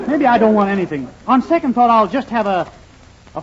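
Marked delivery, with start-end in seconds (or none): none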